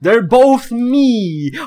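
hammed-up twist ending; dramatic reveal. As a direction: unmask the villain.

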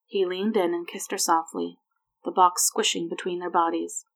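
ordinary delivery, nothing marked

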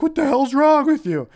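high, loud exasperated dad voice; bewildered dad. Retold or imitated. Dad shouting at screaming son across the yard, baffled. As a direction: yell at your kid in disbelief. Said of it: "my dad is like".

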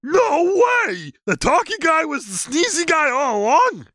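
high dopey creature voice; goofy high-pitched monster; Sasquatch voice. Acted. Sasquatch amazed.

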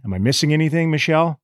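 ordinary talk, nothing marked

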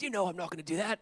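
throaty Aussie neighbor impression; Australian throat voice. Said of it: "he had, like, a throat voice".

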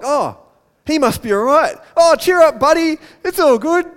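high, loud, breezy reassurance; mock pally encouragement; suddenly friendly crowd. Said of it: "So they're all like".

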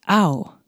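"Au" said like ow; German au sound. Shown as a demonstration. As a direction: say "Au" as an ow diphthong. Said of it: "The combination A U".

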